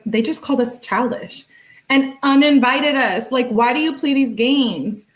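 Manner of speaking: acted-out upset rant; mock indignation. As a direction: complain like an affronted girlfriend. Acting out girlfriend's upset texts.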